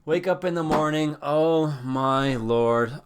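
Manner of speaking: hammed-up hungover wake-up; big groggy groan. Shown as a demonstration. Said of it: "first take said plain".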